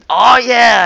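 high, loud burst of triumph; sudden triumphant shout; excited cheer. Real, not acted. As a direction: break off to celebrate the kill. Gets a sniper kill mid-sentence.

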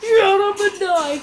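high, loud, drawn-out shout; furious yell. Real, not acted. Gamer screams at an orc charging him.

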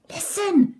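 high, sudden excited call; excited shout. A narrator's reading. Sylvie stopping her friends to hear, excited shout. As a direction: call out to grab attention. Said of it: "shouts Sylvie".

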